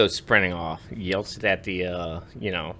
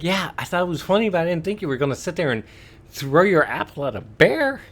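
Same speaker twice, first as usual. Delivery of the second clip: exasperated retort; 9 semitones higher, 5 dB louder than usual. Retold or imitated. Arguing back at the kid.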